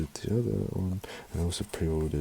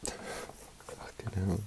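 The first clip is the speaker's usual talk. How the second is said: tearful sigh; 6 dB quieter, much slower than usual. Real, not acted. Overwhelmed, recovering from tears.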